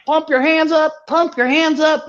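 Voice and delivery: high mock stage call to the crowd; hype man call. Retelling the artist's call to the crowd, high mock hype. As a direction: mimic an artist firing up the crowd. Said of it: "artist was like".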